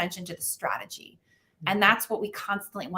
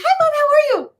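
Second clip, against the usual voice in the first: put-on much higher and louder, sugary child greeting; innocent kid voice; 10 dB louder than usual. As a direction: play the too-sweet little boy who did something. Son voiced as suspiciously sweet.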